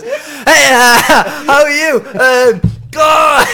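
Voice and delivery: high, loud hammed-up angry greeting; mock angry torrent. Mimicking a mate's aggressive flirting, mock angry shout. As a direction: blast the hello at her like a bark. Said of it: "he's just angry".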